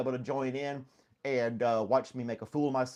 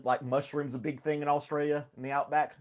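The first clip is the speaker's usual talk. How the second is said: normal talking voice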